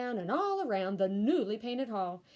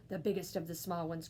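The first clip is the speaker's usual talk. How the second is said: plain reading voice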